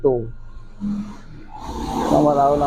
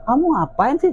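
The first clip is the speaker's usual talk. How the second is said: retold scolding; 12 semitones higher than usual. Retold scolding of shouting child.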